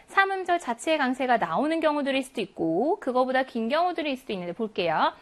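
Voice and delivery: ordinary delivery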